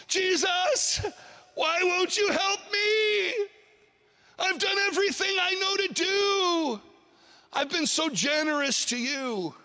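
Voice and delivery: high-pitched, hammed-up tearful complaint; pity-party wailing. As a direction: wail in mock self-pity, dragging out the plea. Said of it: "more of a pity party".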